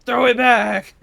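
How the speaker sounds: loud joking yell; playful shout. Pretend. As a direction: ham up the cry for a joke. Joking reenactment of the cry.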